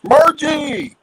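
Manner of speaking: loud call across the room. Real, not acted. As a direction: shout the name to get attention. Calling out a name.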